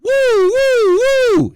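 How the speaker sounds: high, loud celebratory woo; high whooping cheer; excited whoop. Real, not acted. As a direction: whoop like the team just scored. Cheering at the clip.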